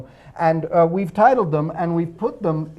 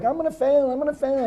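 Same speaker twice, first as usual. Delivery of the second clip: whiny schoolgirl; 7 semitones higher, 5 dB quieter, faster than usual. Retold or imitated. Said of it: "that girl in math class who's like".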